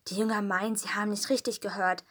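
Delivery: ordinary delivery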